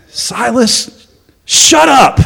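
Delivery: high sudden shout on shut up; annoyed Paul. Acted. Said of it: "Paul's over here like this".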